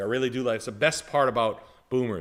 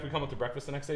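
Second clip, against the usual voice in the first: ordinary talk, nothing marked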